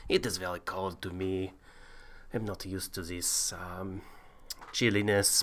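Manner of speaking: a hint of a bad Viking; Viking accent. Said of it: "listening to your bloody accent".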